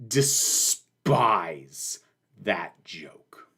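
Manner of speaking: a breathy whisper of contempt; whispered disgust. Real, not acted. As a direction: whisper it like a dark confession. Declaring dislike of a joke.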